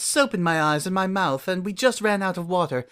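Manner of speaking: put-on a refined older sister asking for a towel, sweetly; grown mare voice. Refined mare asking little sister for a towel, sweetly.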